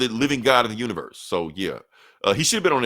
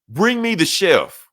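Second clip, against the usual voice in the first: slow, loud, higher demand; commanding Doom; 8 semitones higher, 5 dB louder, slower than usual. Retold or imitated. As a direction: bark the order like a tyrant. Doom angry in comic retelling.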